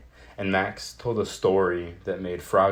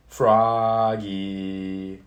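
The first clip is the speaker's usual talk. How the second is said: long, loud call across the house; mother calling loudly for her son; 5 dB louder than usual. A narrator's reading. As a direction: call him from the next room, drawn out. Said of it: "called his mother".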